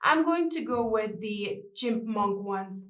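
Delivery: ordinary talk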